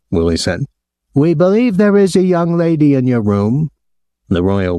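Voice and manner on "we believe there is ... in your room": high-pitched, aggrieved hotel official; aggrieved man. A narrator's reading. Hotel man phoning a guest about his visitor, aggrieved. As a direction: put on a high, officious voice of complaint. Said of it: "a man's voice, aggrieved".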